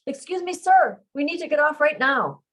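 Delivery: loud high call over the noise; shouting. A narrator's reading. Man shouting down to the ride operator, loud and high. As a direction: call out loud and high to get his attention. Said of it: "I shout to the operator".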